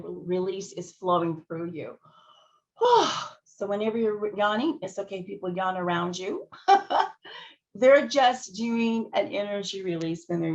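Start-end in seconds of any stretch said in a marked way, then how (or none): 2.8-3.3 audible relaxed sigh. Real, not acted. Loud sigh. 6.5-7.5 laughing. Real, not acted.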